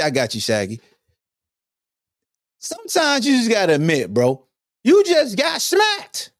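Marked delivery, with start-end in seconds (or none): none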